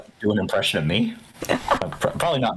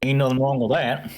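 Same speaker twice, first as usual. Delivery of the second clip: lightly put-on cowboy accent, a touch higher; 4 semitones higher than usual. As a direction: drop into a playful cowboy accent. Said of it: "you can always do a cowboy accent".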